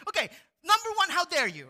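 high, fast, loud sassy outrage; mock-indignant Kelly Kapoor; Kelly Kapoor sass. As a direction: snap it like a sitcom diva. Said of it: "she's like".

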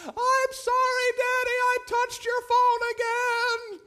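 put-on very high, slow, loud small-child voice; little girl voice. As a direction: play a guilty toddler apologising. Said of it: "She goes".

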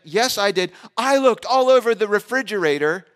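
put-on high, loud child insisting; five-year-old insisting he checked the fridge; indignant kid. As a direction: insist like a wronged little boy. Son voiced insisting he looked.

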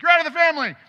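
high, loud shouted rebuke; furious parent yell. Retold or imitated. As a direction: yell it like a parent throwing a kid out. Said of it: "I don't, like, slap them and be like".

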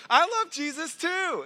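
high-pitched, gleeful retold reply; acted burst of shared joy; excited agreement. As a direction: chime in delightedly, voice way up. Acting his own delighted reply.

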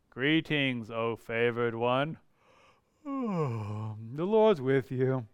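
low, soft, slow and dull; mock-bored angel with a sigh; bored monotone. Shown as a demonstration. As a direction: drone it out like it means nothing. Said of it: "I don't think Gabriel was monotone".

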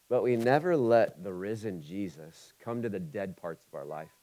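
usual speaking voice

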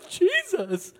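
high, tearful laugh of joy; laughing and crying. Retold or imitated. Disciples overjoyed at seeing Jesus, laugh-crying high. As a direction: break into weeping laughter. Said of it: "They're like".

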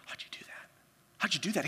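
breathless whispered disbelief; awed whisper. Retold or imitated. Justin whispering in awe at a lucky throw. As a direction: hush it like you can't believe it.

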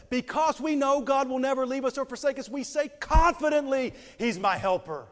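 fervent high loud pulpit voice; preaching at full force. Acted. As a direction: preach it out with conviction.

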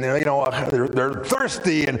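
ordinary delivery

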